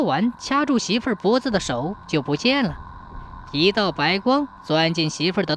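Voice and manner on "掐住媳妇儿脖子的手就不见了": ordinary delivery, nothing marked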